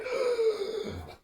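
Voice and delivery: high gasp of breath; imitated gasp of pain; sharp gasp. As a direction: suck in air as if winded. Wind knocked out by firework.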